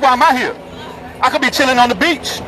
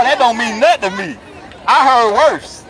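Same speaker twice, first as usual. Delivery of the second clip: ordinary delivery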